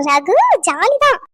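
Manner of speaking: high, drawn-out singsong cheer; gleeful. Acted. Friend cheering that everyone has agreed, gleeful. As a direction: stretch it out loud in playful delight.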